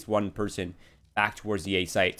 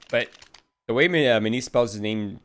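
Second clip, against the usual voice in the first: normal talking voice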